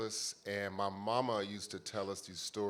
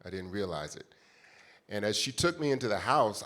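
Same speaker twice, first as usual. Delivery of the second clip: ordinary delivery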